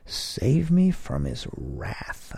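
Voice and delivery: high, slow, breathy disbelief on me; echoing her warning back in amazed disbelief; incredulous exclamation. A narrator's reading. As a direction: scoff at the idea in a hushed high exclaim. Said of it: "I exclaimed".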